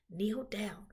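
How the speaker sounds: usual speaking voice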